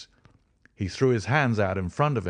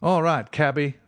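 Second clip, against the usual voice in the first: higher, louder tipsy cabman call; drunk man's voice; 8 semitones higher, 5 dB louder than usual. A narrator's reading. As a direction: slur it like a drunk passenger. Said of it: "said he".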